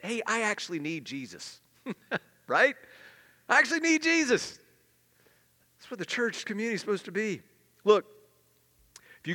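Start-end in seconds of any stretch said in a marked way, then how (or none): 3.5-4.6 much higher and louder, emphatic; loud exclaimed. Shown as a demonstration. Believer admitting to the church, high and emphatic. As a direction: proclaim it with full conviction. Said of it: "We can admit".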